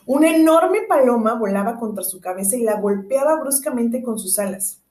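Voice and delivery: usual speaking voice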